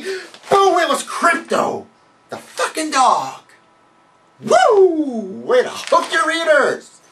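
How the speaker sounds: sarcastic outburst. Acted. Sarcastic rant at Superman reveal.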